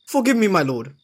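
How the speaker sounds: hasty apology. Acted. Subordinate rebuked by his lord, apologising urgently. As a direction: beg pardon quickly, pitch jumping up.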